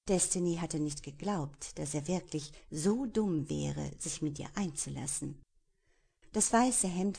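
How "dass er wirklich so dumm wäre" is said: ordinary delivery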